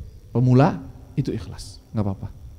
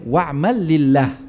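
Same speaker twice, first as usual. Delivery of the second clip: louder, slow, weighty recitation; slow loud Arabic recitation; 5 dB louder, much slower than usual. Acted. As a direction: proclaim the maxim slowly.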